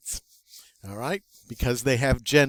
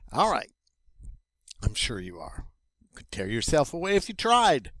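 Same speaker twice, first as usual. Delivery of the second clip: ordinary delivery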